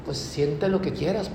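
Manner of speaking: ordinary talk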